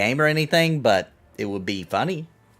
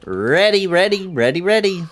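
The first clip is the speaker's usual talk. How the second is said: high chanting of ready; rallying the group, pitched up; excited call; 9 semitones higher, 4 dB louder than usual. Real, not acted. Streamer to the group, chanting ready. Calling out readiness in game.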